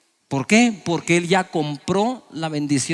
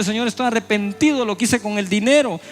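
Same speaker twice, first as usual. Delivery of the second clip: tearful repentant plea; 4 semitones higher than usual. Acted. Preacher acting a debtor praying to God, mock tearful plea. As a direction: beg God like a man in debt, building to a cry. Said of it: "está diciéndole".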